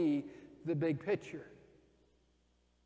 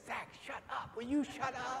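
annoyed sister; 9 semitones higher, 5 dB quieter than usual. Retold or imitated. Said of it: "his sister Rachel said".

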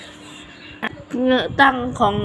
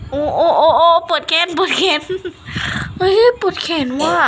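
arm aching; 6 semitones higher than usual. Real, not acted. Gaming too long, complaining her arm hurts, pained laughing groan.

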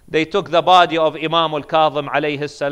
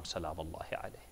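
ordinary delivery

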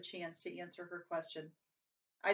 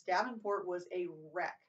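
ordinary delivery

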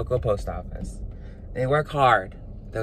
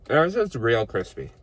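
words around food; talking while chewing; 3 semitones lower, slower than usual. Real, not acted. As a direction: mouth full of sandwich. Seen 1: bit sandwich at -3; cheeks full, jaw working while talking.

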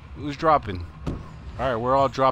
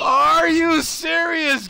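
stunned disbelief; 14 semitones higher, 4 dB louder, much slower than usual. Real, not acted. Disbelief at teammate's play.

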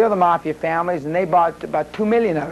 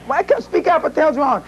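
pitched well higher, startled demand; hearing a frightened whisper on the line, startled and much higher; alarmed; 10 semitones higher than usual. Retold or imitated. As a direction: jump up in pitch, caught off guard. Retold alarmed reply on phone.

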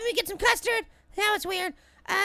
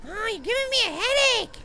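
ordinary talk

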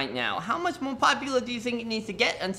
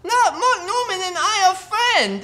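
high, loud squeal of triumph with a laugh; shrieking jackpot winner; 10 semitones higher, 7 dB louder, slower than usual. Acted. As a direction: shriek it like you just won everything. Host overjoyed at friendship.

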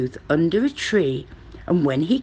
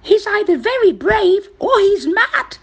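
high, loud monkey chatter; 5 dB louder than usual. A narrator's reading. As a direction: exclaim in a squeaky-high monkey voice. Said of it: "chattered a monkey".

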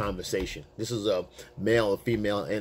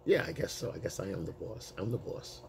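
much softer, understated reply; chilled; 4 semitones lower, 11 dB quieter than usual. Retold or imitated. Man answering her boss challenge, retold as a quiet shrug. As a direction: drop it low and quiet, unbothered. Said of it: "because I'm chilling".

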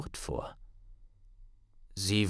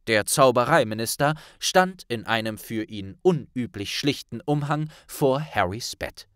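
ordinary delivery

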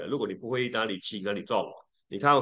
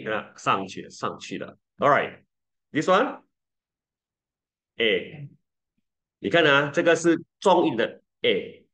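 ordinary talk, nothing marked

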